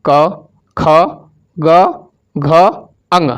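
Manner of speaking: each consonant क, ख, ग, घ, अंग spoken alone with pauses; teacher sounds out क, ख, ग, घ, अंग for the class to repeat; slow recital of the letters. Shown as a demonstration. Teacher leads the class through क, ख, ग, घ, अंग, sounding each out. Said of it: "आप लोग हमारे साथ बोलेंगे".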